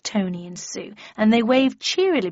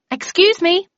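high, loud call to a stranger; raised voice to catch someone's attention; shouting to a passerby; 10 semitones higher, 8 dB louder than usual. A narrator's reading. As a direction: hail someone passing by, pitched right up. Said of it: "I said, shouting to a passerby".

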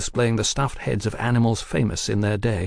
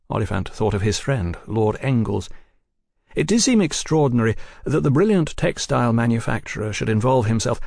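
ordinary delivery, nothing marked

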